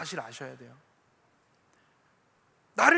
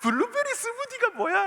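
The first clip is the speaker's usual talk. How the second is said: put-on much higher, childlike daughter voice; imitating daughter; 14 semitones higher than usual. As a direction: play the giggling little daughter asking. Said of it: "막 웃더라".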